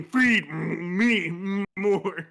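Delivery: put-on slow demanding kid. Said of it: "As he's eating".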